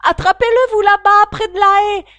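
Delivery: ordre crié, aigu et très fort; lapin qui crie. Acted. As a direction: hurle pour faire attraper le fuyard. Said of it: "la voix du lapin qui criait". (le lapin crie ses ordres, aigu et fort)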